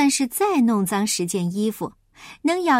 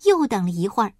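usual speaking voice

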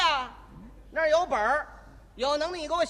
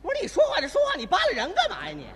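high-pitched indignant complaint with a laugh; rude conductor; 7 semitones higher, faster than usual. Acted. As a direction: snap back, higher, at the passenger. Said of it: "今天我就扮演这位售票员".

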